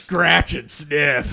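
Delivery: drawn out and loud; slow loud drawl. Acted. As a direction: stretch each word out loud. Slow joke.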